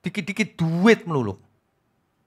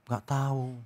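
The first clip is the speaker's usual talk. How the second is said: faint, much softer mock reply; meek reply; 2 semitones lower, 11 dB quieter, slower than usual. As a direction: answer small and timid. Sick man answers. (jawaban lirih dan takut-takut)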